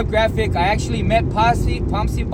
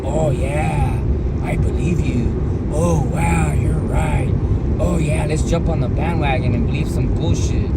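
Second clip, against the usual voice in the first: lower, sarcastic fake agreement; sarcastic mock agreement; 4 semitones lower than usual. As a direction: play along with heavy sarcasm. Mocking gullible viewers' reaction.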